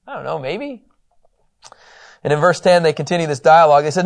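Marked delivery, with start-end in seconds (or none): none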